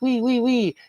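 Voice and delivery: high wailing wee wee wee like an ambulance siren; siren imitation. Comic host imitates the ambulance siren arriving for Hulk, high mock wail. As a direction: sing it out like a passing siren.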